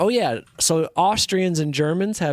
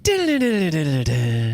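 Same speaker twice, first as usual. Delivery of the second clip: slow, drawn-out host announcement; game show host; 2 semitones higher, much slower than usual. Pretend. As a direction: stretch the word like a TV host. Dramatic game show host reading.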